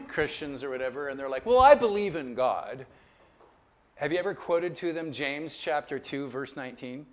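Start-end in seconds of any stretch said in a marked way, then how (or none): 1.5-2.9 louder imitation of a defensive believer; mock protest. Imitating people who avoid being Christians.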